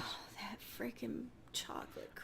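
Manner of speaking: breathy craving whisper. Real, not acted. Craving whisper about pie.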